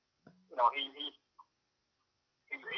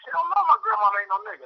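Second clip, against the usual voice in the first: high, louder mock outrage; indignant kid; 5 dB louder than usual. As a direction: throw it back as a shocked teen. Kid answering about his grandma.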